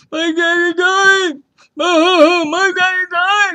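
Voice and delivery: loud, high, drawn-out mock wail; imitated crying. Young woman crying over her father, imitated wailing. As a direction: wail it slowly, stretching every word.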